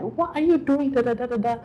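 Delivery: high-pitched mock scolding from a sister; imitated scolding. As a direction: retell a telling-off, trailing into da-da-da filler. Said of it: "She started scolding me".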